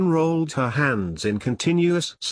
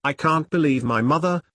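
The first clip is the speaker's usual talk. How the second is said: ordinary delivery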